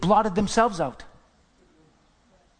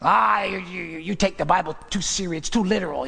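mock scoffing, dropping lower as it goes; mocking scoffer; 3 semitones lower than usual. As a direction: lightly imitate someone making fun of a believer. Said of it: "They make fun of it".

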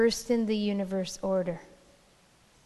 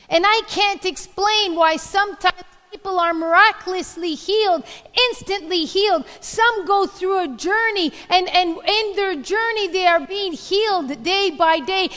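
preaching; 9 semitones higher, 9 dB louder than usual. Acted. Preaching at full force again.

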